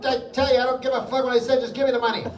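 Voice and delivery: high, loud acted tirade, pushing on without a break; angry blurted outburst. Man blurts out to his wife in an elevator, angry outburst. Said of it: "the guy blurts out".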